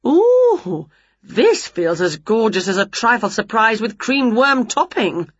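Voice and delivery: high, drawn-out ooh, then settling into relish; gushing storybook witch; delighted Winnie. Acted. Winnie to her cat on trying the ball gown, delighted.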